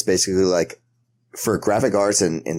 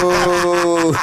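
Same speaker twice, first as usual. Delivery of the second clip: real laughter; 11 semitones higher than usual. Real, not acted. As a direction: burst out laughing. Laughs at the joke.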